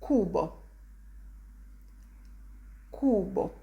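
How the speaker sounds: cubo as "KOO-bo", stressed long oo; Italian word demo. Shown as a demonstration. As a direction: say cubo with hard k and pure Italian vowels. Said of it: "An example of this pronunciation would be".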